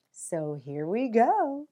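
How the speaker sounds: ordinary delivery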